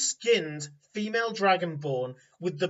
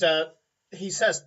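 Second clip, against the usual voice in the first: usual speaking voice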